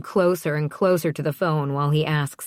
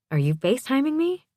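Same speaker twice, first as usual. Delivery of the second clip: much higher, quick, rising question; surprised question; 10 semitones higher, faster than usual. A narrator's reading. Surprised by an incoming video call.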